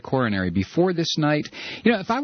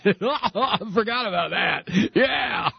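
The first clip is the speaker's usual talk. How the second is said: laughing; 6 semitones higher, slower than usual. Real, not acted. Laughs at own joke.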